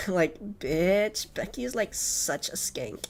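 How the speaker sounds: put-on Valley Girl voice, fading to whisper; airy valley girl, trailing off; Valley Girl accent. Shown as a demonstration. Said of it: "Oh, I could probably do that".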